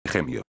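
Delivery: "gemio" begun with a strong Spanish J; hard J opening on "gemio". Shown as a demonstration. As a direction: say "gemio" as "jemio" with firm friction. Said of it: "suena como una J fuerte".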